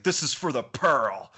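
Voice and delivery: put-on hammy Brooklyn accent, a bit loud; cartoonish Brooklyn accent. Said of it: "in his cartoonish Brooklyn accent".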